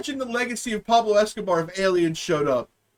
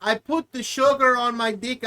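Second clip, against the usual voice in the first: ordinary talk, nothing marked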